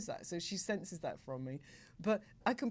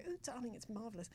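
posh friend; 4 semitones higher, 9 dB quieter than usual. Retold or imitated. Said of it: "She was very, very posh".

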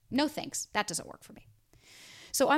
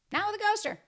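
mock sing-song tag line, pitched way up; sing-song high; 13 semitones higher, 3 dB louder than usual. Shown as a demonstration. Closing tag line to viewers, in a much higher sing-song. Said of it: "repeated closing line".

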